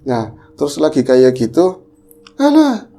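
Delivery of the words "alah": panicked relative. Retold or imitated. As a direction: voice a frightened family member. Menirukan orang panik. (suara panik keluarga, tinggi)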